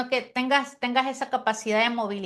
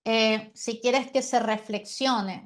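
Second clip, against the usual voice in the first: ordinary talk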